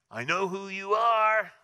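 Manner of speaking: high, loud, strange demon shriek; demonic voice. Acted. Possessed man confronting Jesus, loud and high.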